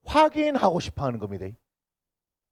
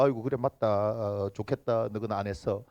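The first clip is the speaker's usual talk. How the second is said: much softer, lower, resigned giving in; meek conceding mutter; 10 semitones lower, 8 dB quieter than usual. Retold or imitated. Pastor voices his side caving in to the accusation, quietly resigned. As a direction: back down quietly, embarrassed. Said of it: "이렇게 될 거".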